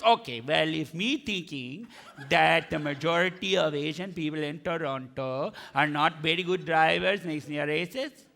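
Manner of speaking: put-on low, measured Indian-accented reply. Cab driver conceding the point to his passenger, Indian accent. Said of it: "got that accent".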